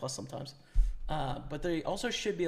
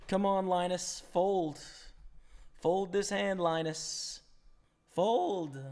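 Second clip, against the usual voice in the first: excited pleading; 5 semitones higher, much slower than usual. Real, not acted. Pleading with opponent to fold.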